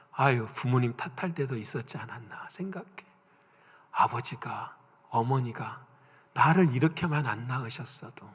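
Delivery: hushed, unvoiced inner thought; whispered confession. Real, not acted. Preacher confesses to congregation, soft whisper. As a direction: breathe it like a secret. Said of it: "preacher confessing private thought".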